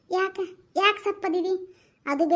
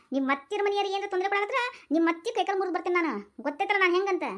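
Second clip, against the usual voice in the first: ordinary talk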